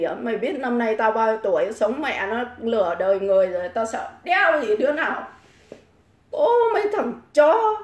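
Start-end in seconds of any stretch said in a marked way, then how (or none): 6.3-7.8 scornful exclamation. Real, not acted.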